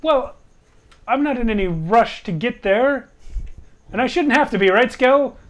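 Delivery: high, loud in-character protest; defensive character retort. Acted. Player answers in character, arguing.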